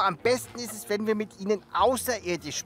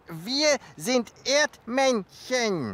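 very slow, over-clear words; 2 semitones higher, much slower than usual. Pretend. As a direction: spell it out for foreigners. Slow clear talk to aliens.

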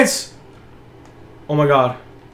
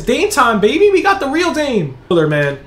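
usual speaking voice

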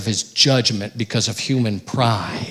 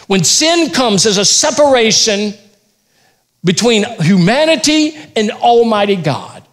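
raised, loud preacher's delivery; forceful preaching; 9 semitones higher, 5 dB louder than usual. Acted. Preacher warns the church about sin, full force. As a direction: drive the point home to the congregation.

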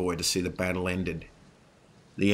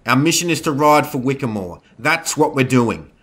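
loud, raised-pitch command; forceful order; 7 semitones higher, 10 dB louder than usual. A narrator's reading. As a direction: lay down the law at a shout. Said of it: "Zoe ordered".

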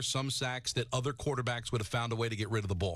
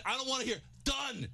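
high-pitched outraged ranting at a player; angry rant; 9 semitones higher than usual. Real, not acted. Host raging at the Giants lineman over his insult, furious ranting. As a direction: boil over, voice climbing high. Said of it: "that angers me".

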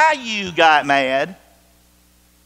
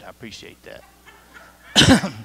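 laughing thanks; 8 semitones lower, much slower than usual. Real, not acted. Laughs at yawning listeners.

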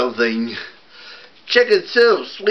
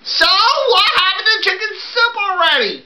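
put-on high child voice; faster than usual. Kids call out to Rosie.

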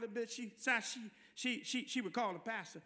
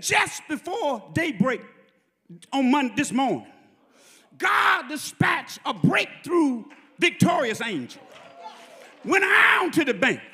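loud, high, drawn-out sermon climax; preacher at full cry, stretching key words; full-force preaching; 7 semitones higher, 16 dB louder, slower than usual. Acted. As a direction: shout it to the congregation, phrase by phrase.

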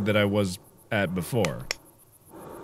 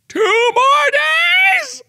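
high, slow disbelieving cry; shocked shout; 5 dB louder, much slower than usual. Acted.

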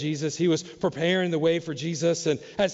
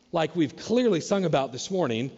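ordinary delivery

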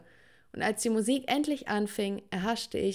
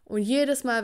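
ordinary delivery

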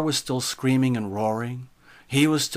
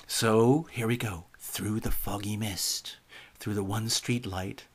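said for effect; 3 semitones lower, 6 dB quieter than usual. Shown as a demonstration. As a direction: hush it for spooky effect. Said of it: "I just said that for effect".

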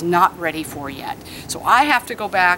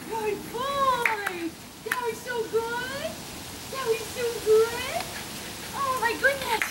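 much higher and softer gushing praise with a laugh; high-pitched dog praise; 13 semitones higher, 8 dB quieter, slower than usual. Real, not acted. Trainer celebrating her dog during free play, very high gushing praise. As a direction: gush over him like he won the world.

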